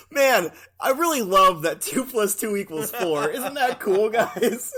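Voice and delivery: put-on loud, giddy, laughing kid voice; goofy excited Corbin. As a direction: gush about math like a dopey kid. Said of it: "And he's like".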